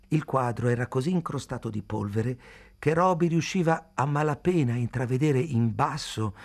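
usual speaking voice

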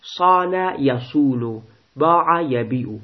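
slow, deliberate verb forms; slow classroom drill; slow recitation. Shown as a demonstration. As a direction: recite each form slowly for students. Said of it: "در مضارع اجوف چی میگی؟".

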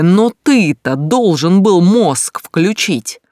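furious yell, slow and loud; angry shout. Acted. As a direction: burst in yelling at him. Vizglyakov bursts in shouting. (гневный крик)

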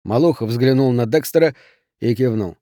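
usual speaking voice

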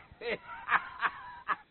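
airy high snicker; sly unvoiced giggle; breathy mocking laugh. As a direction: laugh at the trick you pulled. Laughs at his own trick.

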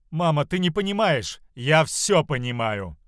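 loud, emphatic protest; acted exasperated outburst; heated quarrel. Quarrel between mother and daughter.

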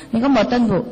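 ordinary talk, nothing marked

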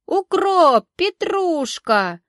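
very high, loud, long-drawn call; hawker's cry. A narrator's reading. Greens seller to the whole market, high drawn-out shout. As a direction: stretch each word across the market.

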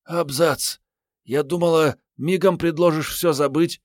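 put-on high, lively girl's voice; surprised young woman. A narrator's reading. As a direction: marvel at him in a high voice. (высокий девичий голос, с удивлением)

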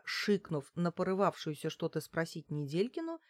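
usual speaking voice

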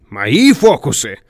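loud, drawn-out indignant comeback; slow, forceful, seething retort; hissed retort. A narrator's reading. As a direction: throw the accusation back at a rival. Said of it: "прошипел его бледный соперник".

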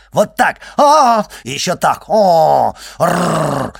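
loud, high mocking yowls ending in a growl; yowling cat imitation. A narrator's reading. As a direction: demonstrate an alley cat screaming. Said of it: "орёт, как помойная кошка". (передразнивать вопли помойной кошки)

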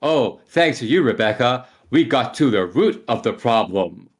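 hammed-up delivery of a dad joke; dad-joke hero. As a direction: play a badly voice-acted hero landing his pun. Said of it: "just makes dad jokes the whole time".

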